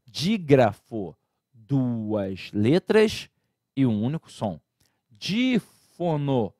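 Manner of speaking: "Dígrafo" and "Dífono" drawn out very slowly; slow emphasis. Shown as a demonstration. Teacher contrasts the terms slowly for students. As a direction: stretch each term for note-taking. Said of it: "a diferença do dígrafo para o dífono".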